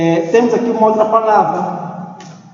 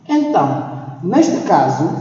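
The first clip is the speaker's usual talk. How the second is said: normal talking voice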